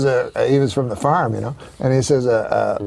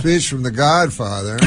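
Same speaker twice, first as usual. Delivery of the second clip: ordinary talk, nothing marked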